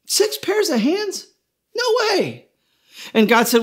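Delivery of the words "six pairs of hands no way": much higher, slow disbelieving question; can't believe what she's hearing; incredulous angel. Acted. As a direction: angel balking at six pairs of hands. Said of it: "The angel shook her head and she said".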